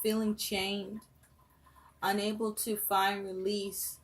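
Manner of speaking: usual speaking voice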